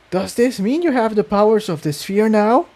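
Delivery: put-on high-pitched worried young pony; Tiptoe voice. A narrator's reading.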